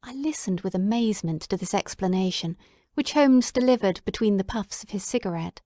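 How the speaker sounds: ordinary delivery, nothing marked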